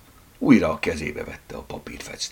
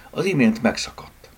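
plain reading voice

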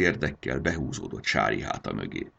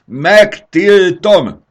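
higher, much louder, slow bellowed ban; furious dragon mother roaring a ban; 18 dB louder, much slower than usual. Acted. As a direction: roar the forbidding word. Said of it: "üvöltötte".

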